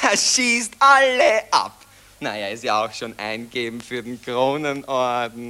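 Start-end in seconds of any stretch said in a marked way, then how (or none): 0.0-1.7 laughing, delighted praise; gleeful. Acted. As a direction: relish every word. Begeistertes Lob des Schützen. (begeistert und lachend)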